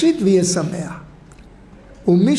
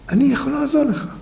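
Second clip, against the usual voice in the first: put-on eager child; 6 semitones higher, faster than usual. Child insists on helping.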